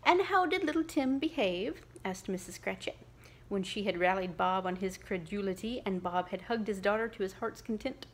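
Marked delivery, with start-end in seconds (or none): 0.0-1.8 higher, louder mother's question; lightly voiced mother; curious mother. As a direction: ask eagerly about Tim. Mother asking Bob.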